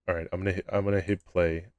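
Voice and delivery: normal talking voice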